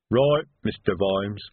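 slow, low troll voice; Detritus. Acted. Troll sergeant obeying his boss, slow low rumble. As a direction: answer as an obedient troll, low and dragging. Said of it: "said Detritus".

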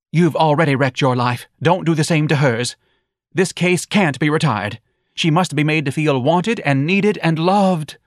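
reproachful wife. A narrator's reading.

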